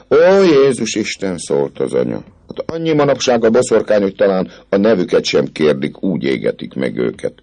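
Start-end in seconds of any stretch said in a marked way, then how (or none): 0.1-1.4 put-on high, slow old lady's cry; mother's exclamation. 2.5-7.3 put-on higher, chattering old lady; fussy old mother. Mother speaking.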